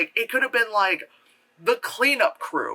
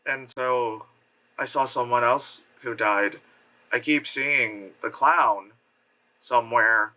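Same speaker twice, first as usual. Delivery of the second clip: low, slow, halting delivery; mock wooden acting, pitched low; one-note line reading; 7 semitones lower, slower than usual. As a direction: read it lifelessly with long gaps between phrases. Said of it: "like that type of, like, line reading".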